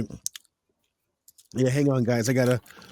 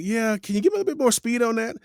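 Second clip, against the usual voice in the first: higher, quick imitated director; director's request; 10 semitones higher, much faster than usual. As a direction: mimic a director asking in a raised, hurried voice. Director's first request for speed.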